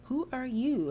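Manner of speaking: high, exaggerated mimicking question; mocking. [name] imitates the girl confronting her, mocking. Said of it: "And she's mocking her".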